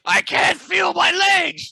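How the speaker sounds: high, slow, panicked shout; panicked scream. Retold or imitated. Reenacting a movie scene.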